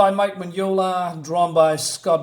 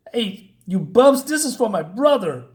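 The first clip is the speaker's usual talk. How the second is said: acted vengeful challenge; 6 semitones higher, 4 dB louder than usual. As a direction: call out enemies before taking revenge. Avenger confronts the gangsters.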